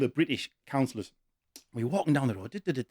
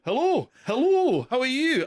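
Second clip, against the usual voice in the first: a light Billy Connolly voice; 9 semitones higher, 3 dB louder, slower than usual. Retold or imitated. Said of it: "He went".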